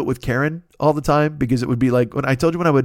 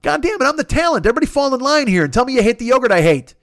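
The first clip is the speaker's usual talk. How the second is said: much higher, hammed-up indignant rant; mock outrage; 8 semitones higher than usual. Ranting at chat who love Noosa, hammed-up outrage. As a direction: play the spoiled star demanding loyalty.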